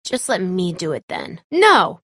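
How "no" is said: sharp no. Acted. Stopping someone from acting.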